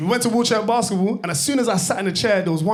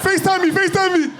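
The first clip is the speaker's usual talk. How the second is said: high, loud excited yelling of a request; excited call; 12 semitones higher, 4 dB louder than usual. Retold or imitated. Friend calling from the ride queue, high and loud. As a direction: shout it up like a thrilled friend begging.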